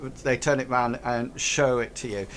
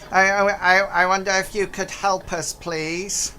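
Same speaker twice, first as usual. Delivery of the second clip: higher, louder stammering little goblin; 6 semitones higher, 3 dB louder than usual. Acted. Space goblin pleading with the players for help. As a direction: stutter out a timid plea for help.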